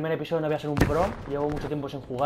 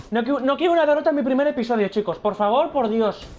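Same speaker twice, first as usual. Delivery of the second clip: desperate pleading; 6 semitones higher, 4 dB louder than usual. Real, not acted. Begging not to lose.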